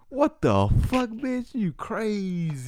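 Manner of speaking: high, slow, incredulous "you crazy"; stunned by a stranger's threat; outraged disbelief. Retold or imitated. Outraged retort, acted quote.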